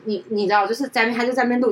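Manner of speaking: ordinary talk, nothing marked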